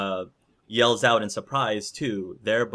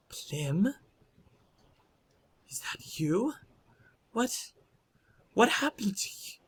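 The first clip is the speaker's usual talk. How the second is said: high, soft, whispery questions; shocked disbelief; 11 semitones higher, 3 dB quieter than usual. Acted. Woman in shock recognising the werewolf, hushed and disbelieving. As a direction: ask haltingly, voice small and pitched way up. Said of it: "is in shock".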